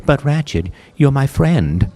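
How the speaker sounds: a lightly voiced robot Clank; Clank's voice. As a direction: plead it sincerely as the friendly robot. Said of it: "Clank is a robot".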